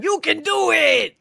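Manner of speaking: slow high mock cheer; cheering quote. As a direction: drag it out like a hype man. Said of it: "A quote from that movie".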